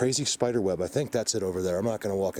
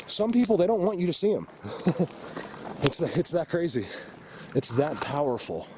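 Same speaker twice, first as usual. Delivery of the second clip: excited; 8 semitones higher than usual. Real, not acted. Excited rant about hidden creatures.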